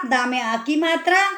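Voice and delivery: ordinary talk, nothing marked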